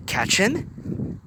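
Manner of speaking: confused question. A narrator's reading. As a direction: doubt what he just said. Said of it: "questioned in confusion".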